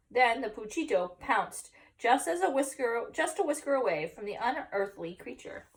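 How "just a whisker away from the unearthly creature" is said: plain reading voice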